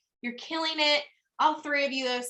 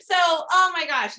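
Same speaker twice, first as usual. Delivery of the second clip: ordinary talk, nothing marked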